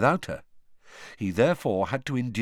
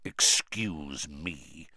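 low, slow growl; 7 semitones lower, 5 dB quieter, much slower than usual. Acted. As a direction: growl it as a warning. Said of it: "growled Moody".

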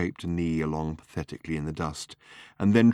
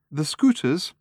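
little lady voice; 10 semitones higher, 5 dB louder than usual. A narrator's reading. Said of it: "said the little lady severely".

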